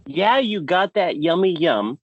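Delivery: ordinary talk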